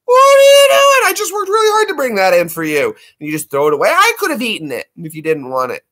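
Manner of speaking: high falsetto screech easing into aggrieved complaint; imitated indignant cat. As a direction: play an outraged pet, shrieking then settling. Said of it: "she wasn't very happy".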